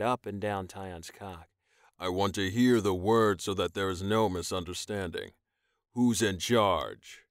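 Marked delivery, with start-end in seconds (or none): 2.0-7.2 firm, slowing to a deliberate question. Acted. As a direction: spell out who is in charge. Rojan demanding.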